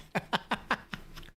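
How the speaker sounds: high, soft wheezy giggle; trailing staccato laugh. Real, not acted. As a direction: keep laughing in short bursts. Cracks up at the nickname.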